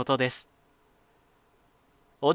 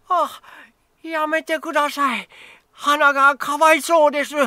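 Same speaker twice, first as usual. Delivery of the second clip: put-on much higher, louder desperate old man; old man begging drunk ruffians to spare his peony, much higher and louder; frantic plea; 12 semitones higher, 8 dB louder than usual. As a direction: cry out to stop them in alarm. Old man begs ruffians stop.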